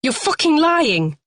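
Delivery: high, loud furious denial. A narrator's reading. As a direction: shout it down the line. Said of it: "then louder".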